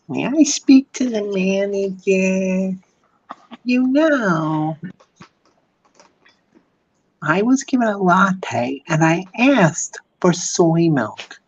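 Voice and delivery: high, slow, entitled complaining customer; mock Karen at the counter. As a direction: whine like a demanding Karen. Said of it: "The Karens are annoying".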